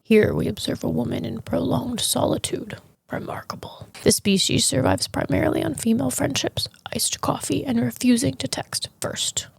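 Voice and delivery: hushed nature documentary narration; David Attenborough impression. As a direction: whisper it like a wildlife narrator. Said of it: "Like David Attenborough".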